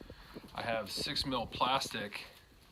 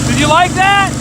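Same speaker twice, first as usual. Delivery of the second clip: calling out; 24 dB louder than usual. Real, not acted. Calling to kids after sled run.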